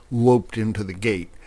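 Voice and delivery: ordinary delivery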